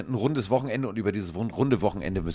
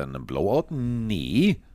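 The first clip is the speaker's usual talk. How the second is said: ordinary talk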